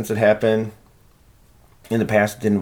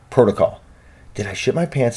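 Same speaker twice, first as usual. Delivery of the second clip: normal talking voice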